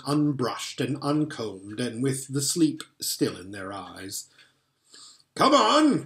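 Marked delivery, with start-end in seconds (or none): 5.3-6.0 high, loud gleeful call; delighted cry. Acted. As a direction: cry out, almost dancing. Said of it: "cried Mister Beaver, who was almost dancing with delight".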